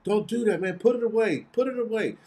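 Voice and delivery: distant call. Retold or imitated. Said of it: "from a long distance away".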